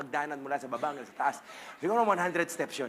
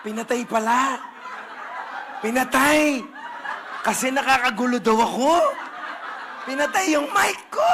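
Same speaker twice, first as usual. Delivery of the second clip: laughing; 6 semitones higher, 8 dB louder than usual. Real, not acted. Laughing at own story.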